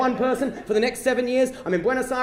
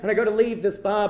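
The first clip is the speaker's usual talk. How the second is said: ordinary talk, nothing marked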